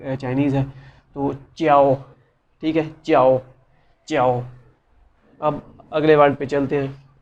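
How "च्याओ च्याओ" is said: usual speaking voice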